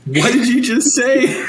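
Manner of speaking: high, slow, incredulous mock gasp; laughing as you play their shock; stunned disbelief. Coaches react to the rookie's question, mock stunned. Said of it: "looked at me like".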